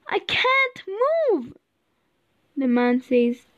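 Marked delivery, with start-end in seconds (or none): 0.1-1.5 high, slow, drawn-out complaint; high-pitched storybook Tin Man in trouble; stuck Tin Man. A narrator's reading. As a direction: plead helplessly in a high voice. Stuck Tin Man answers in distress.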